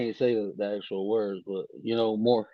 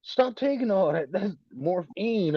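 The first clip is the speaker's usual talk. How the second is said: quoting the lady; 7 semitones higher, slower than usual. Retold or imitated. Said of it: "the lady was like".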